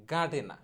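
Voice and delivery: গার্ডেনা as "ga-de-na", both r's silent; corrected pronunciation. Shown as a demonstration. Teacher correcting gardener, গার্ডেনা as "ga-de-na" without r. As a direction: say গার্ডেনা ending on an open a. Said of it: "সঠিক উচ্চারণটা হবে".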